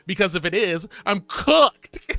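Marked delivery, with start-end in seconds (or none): 1.1-2.1 sudden high, loud cry of doom; mock despair. Real, not acted. To viewers, dreading the dating scene, a high loud mock wail. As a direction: wail it like it's all over.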